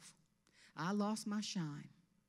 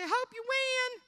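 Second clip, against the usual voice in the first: high, loud pageant gush; hammed-up insincere good wishes; fake well-wishing; 7 dB louder than usual. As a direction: squeal it as an obvious sugary lie. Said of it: "and you lie so bad".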